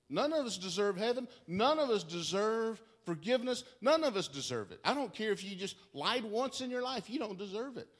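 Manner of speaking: preacher pressing the point on the weeping woman, loud; preaching emphatically. Acted. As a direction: hammer it home to her.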